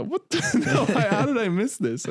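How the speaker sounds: very high, laughing, stunned; retold surprise. Finding the post-credit scene, high laughing astonishment. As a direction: yelp it in high disbelief and laugh. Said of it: "And I go".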